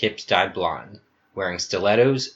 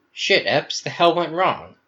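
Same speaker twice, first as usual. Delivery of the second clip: higher, sharp, upset challenge; Gamma's alarmed demand; 8 semitones higher, 4 dB louder than usual. Acted. Gamma confronting Epsilon, higher and alarmed. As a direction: demand answers from a friend who went rogue.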